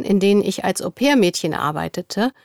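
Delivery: normal talking voice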